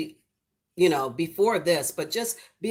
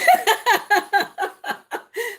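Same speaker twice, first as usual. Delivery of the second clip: real high squealing laugh; loud joyful squeal of laughter; 8 dB louder than usual. Real, not acted. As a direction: burst into delighted laughter. Laughing at friend's tears.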